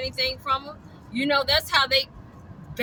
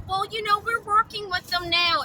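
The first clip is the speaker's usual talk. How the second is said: mocking quote; 9 semitones higher than usual. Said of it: "I see she's all of a sudden".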